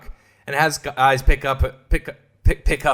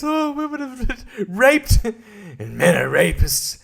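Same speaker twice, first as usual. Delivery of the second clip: mocking parody; 6 semitones higher, slower than usual. Mocking the film's message.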